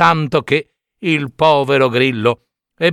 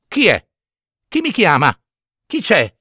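frightened; 4 semitones higher than usual. A narrator's reading. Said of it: "tutto impaurito".

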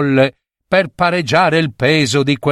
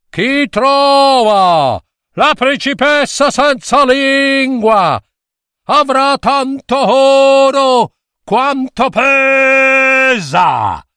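royal proclamation shout; 10 semitones higher, slower than usual. A narrator's reading. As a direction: boom it across the kingdom like a king. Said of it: "ingrossando la voce più che poteva". (bando urlato dal re, voce alta e lenta)

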